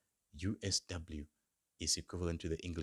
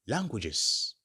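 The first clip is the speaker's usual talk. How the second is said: Languages said louder and higher as a headword; teacher stressing Languages; emphatic key word; 4 semitones higher, 5 dB louder than usual. Shown as a demonstration. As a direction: announce Languages firmly. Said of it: "Remember, it's languages".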